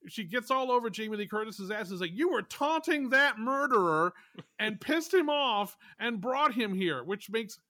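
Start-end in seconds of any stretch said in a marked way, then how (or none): 2.1-7.0 loud, slow accusation; retold yelling. Woman in wheelchair blaming Jamie Lee Curtis, yelling. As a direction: berate with drawn-out, higher emphasis. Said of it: "where she yells at".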